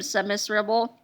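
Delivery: ordinary delivery, nothing marked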